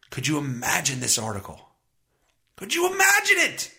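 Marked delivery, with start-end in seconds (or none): 2.5-3.7 high, outraged disbelief; incredulous. Real, not acted. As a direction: let the pitch leap in disbelief. Rising outrage at double standard.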